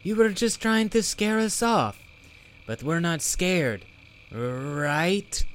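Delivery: high, loud cheerful creature; rally her, bright and high; Bobble voice. Acted. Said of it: "said Bobble".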